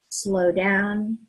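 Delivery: ordinary talk, nothing marked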